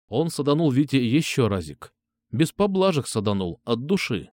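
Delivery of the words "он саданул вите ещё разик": plain reading voice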